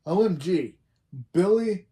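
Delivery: loud, high gasp of amazement at Billy; amazed OMG. Retold or imitated. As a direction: act stunned that he got it right. Friends amazed Billy knows answer.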